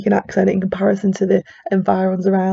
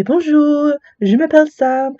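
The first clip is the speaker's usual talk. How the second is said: higher-pitched, put-on French accent; 7 semitones higher than usual. Shown as a demonstration. Introducing yourself to French people so it sounds French, French accent. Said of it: "put on a French accent".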